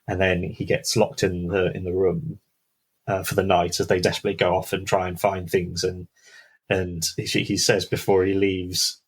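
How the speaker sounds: ordinary delivery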